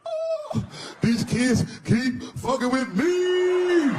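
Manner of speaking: high, drawn-out hammed-up wail; mock wailing cry. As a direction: wail it like an overdramatic kid. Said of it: "crying like he was [name]".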